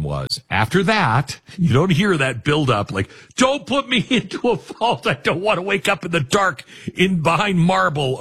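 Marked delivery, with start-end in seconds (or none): 3.3-8.2 high-pitched, hammed-up pleading; wailing mock dread, pitched way up; mock panic. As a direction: beg in exaggerated terror of the vault. Mock pleading as dying Washington.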